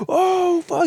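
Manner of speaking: high excited burst of joy; happy shout of recognition. Retold or imitated. Old friend finally recognizing him, high and excited. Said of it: "He got all excited".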